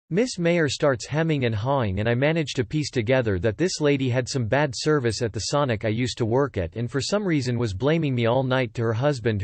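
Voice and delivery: plain reading voice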